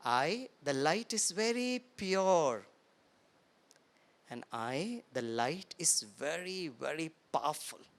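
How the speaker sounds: very slow, soft and low; guided affirmation, slow and soft; meditative affirmation. Shown as a demonstration. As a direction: breathe it out slowly. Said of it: "यही सिखाते हैं".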